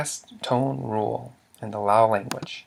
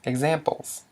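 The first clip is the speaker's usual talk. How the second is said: normal talking voice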